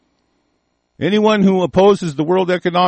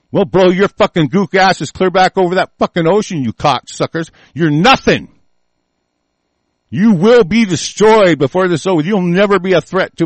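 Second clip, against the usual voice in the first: angry threat; 4 semitones higher, 4 dB louder than usual. Real, not acted. Threatening rant against China.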